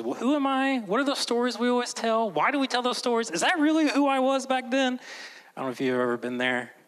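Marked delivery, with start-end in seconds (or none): 0.0-5.0 high-pitched run of worried questions; mock fretting in a high voice; anxious self-questioning. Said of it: "you begin to ask questions".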